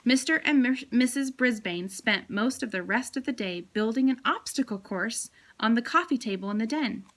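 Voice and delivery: usual speaking voice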